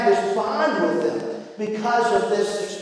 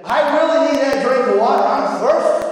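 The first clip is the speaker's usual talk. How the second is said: put-on higher, louder, hurried kid plea; pleading boy; 4 semitones higher, 4 dB louder than usual. Boy begging dad for a drink, insistent raised plea. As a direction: beg like a child stalling bedtime.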